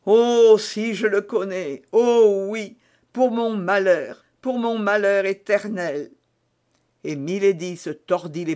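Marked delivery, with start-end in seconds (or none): 0.1-6.1 higher, louder cry of grief; anguished lament. A narrator's reading. Milady laments her misfortune to Felton. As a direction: pour out feigned despair. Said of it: "au paroxysme de la douleur".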